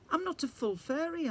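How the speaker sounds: put-on high, pleading child; worried girl. A narrator's reading. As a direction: plead your case. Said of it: "I said".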